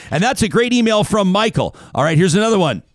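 Jim Rome. Retold or imitated. Radio host to listeners between emails, imitated sports-radio patter. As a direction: wrap up an email brightly, then move on. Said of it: "the classic Jim Rome move".

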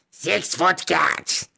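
slow, put-on creature grumble; indignant kobold. Freed kobold muttering about its attacker, slowly indignant. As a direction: repeat it slowly, every word drawn out.

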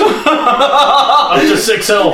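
drawn out through giggles; laughing, slow and high. Real, not acted. Gloating to a friend about surviving, slow laughing brag. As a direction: savour it while laughing.

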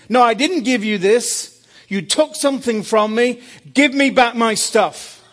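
loud stern rebuke. Retold or imitated. Jesus correcting Graham, loud stern rebuke. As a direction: set him straight with force.

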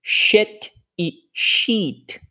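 slow "shit e sheet", short vowel then long ee; shit and sheet contrast. Shown as a demonstration. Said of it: "são palavras como".

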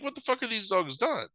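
high, baffled disbelief; a high mock outrage; incredulous. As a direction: ask it in total disbelief.

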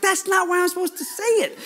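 high-pitched exasperated objection; hammed-up, high pleading complaint; mock protest. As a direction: play up a whiny protest to the boss. Acted protest to Holy Spirit's prompt.

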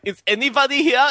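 put-on high, loud German accent. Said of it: "in a German accent".

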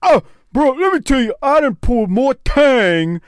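high, boastful put-on voice; mocking guest impression. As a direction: mimic his bragging, drawing out the last word. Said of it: "'Cause he said something about the women".

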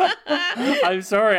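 high, loud, slow laugh through the words; real laughter while saying sorry; laughing apology. Real, not acted. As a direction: giggle out an apology.

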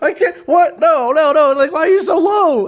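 high, loud, laughing panic; high laughing panic cutting off mid-word; mock panic. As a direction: squeal like a man about to be taken down. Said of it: "You're like".

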